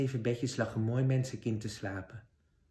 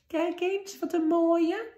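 put-on much higher, slow quavering old crone voice; trembling old woman; 4 dB louder, slower than usual. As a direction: quaver like a frail old woman hiding a wicked queen. Old woman shows the apples.

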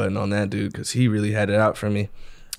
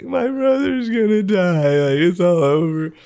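mock sobbing sister; 8 semitones higher, 5 dB louder, slower than usual. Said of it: "sobbing in my room".

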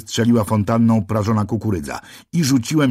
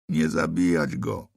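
softer, slow, moaning plea; 4 semitones lower, 4 dB quieter than usual. A narrator's reading. Narrator moans to the policeman about the gorilla, slow and soft. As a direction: moan it out in grief. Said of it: "zajęczałem".

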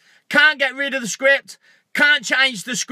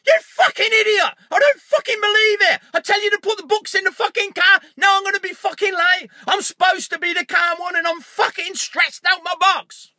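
high, loud, furious acted rant; hammed-up screaming outburst; negative voice's tirade; 9 semitones higher, 3 dB louder than usual. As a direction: explode at your partner in a fast, stressed-out shout. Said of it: "my negative voice would like to have a word".

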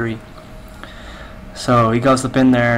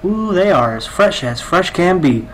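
mock sales pitch; 6 semitones higher than usual. Cashier recites rehearsed line.

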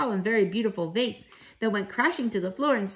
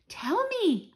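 put-on high, pleading child's voice; begging child; 10 semitones higher than usual. Mouse grandchild begging for the answer, pleading.

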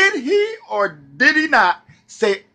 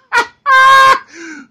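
high, loud burst of laughter; 13 semitones higher, 7 dB louder than usual. Real, not acted. Laughing at the coal miners' fate, loud and high. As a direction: really crack up.

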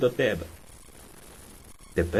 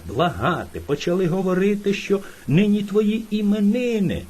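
plain reading voice

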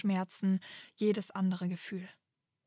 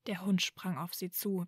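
ordinary delivery, nothing marked

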